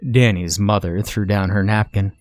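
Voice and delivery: plain reading voice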